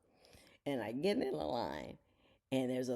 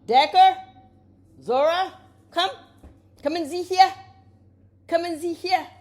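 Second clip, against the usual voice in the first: high, loud summoning call; calling dogs; 14 dB louder than usual. Real, not acted. Owner calling Decker and Zora to show viewers, loud call. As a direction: shout out sharply for the dogs to come.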